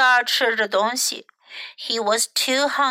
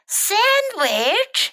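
high, rising disbelief; incredulous; 8 semitones higher than usual. A narrator's reading. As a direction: echo the offer as an appalled question. Bird balks at offered sandwich.

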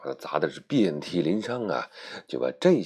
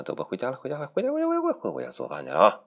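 crowd calling home; 6 semitones higher, much faster than usual. Acted.

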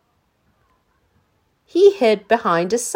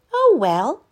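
put-on a boy's higher shrug; resigned Danny; 6 semitones higher, 5 dB louder than usual. A narrator's reading. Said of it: "thought Danny".

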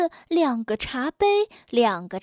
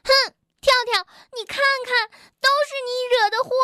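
very high, rapid angry blame; huff, then scold fast and high; loud scolding; 11 semitones higher, much faster than usual. A narrator's reading. Bengbeng blames Tiaotiao for the accident, scolding. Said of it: "大声地责备道".

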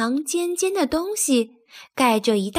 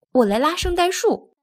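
eager, higher-pitched happy offer; 7 semitones higher than usual. A narrator's reading. Thomas volunteers to pull the Christmas tree, happy. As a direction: pipe up gladly, pitched higher. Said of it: "托马斯高兴地说".

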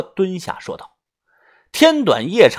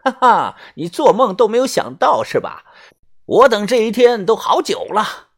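hammed-up movie villain; 7 semitones higher than usual. Mocking silly film unmasking lines, high villain gloat. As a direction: crow it like a cheesy screen villain. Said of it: "还会说一些傻逼的台词".

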